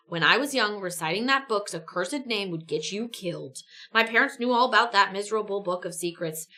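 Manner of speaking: ordinary talk, nothing marked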